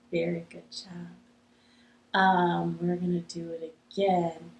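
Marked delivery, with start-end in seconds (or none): none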